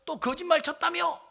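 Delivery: high voice building to a shout; angry, abusive accusation. Retold or imitated. In-law accusing preacher of lying to dying daughter, angry shouting. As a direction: imitate an irate in-law laying into you. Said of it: "욕을 하면서".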